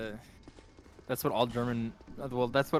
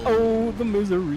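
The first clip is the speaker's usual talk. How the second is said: mock misery; 5 semitones higher, 7 dB louder than usual. Groaning at game death.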